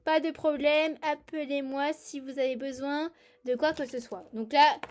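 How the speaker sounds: ordinary talk, nothing marked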